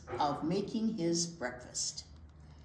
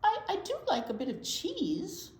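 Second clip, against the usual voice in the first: mouse voice; 9 semitones higher than usual. Acted. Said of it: "said the mouse".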